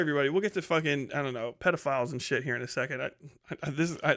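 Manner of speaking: usual speaking voice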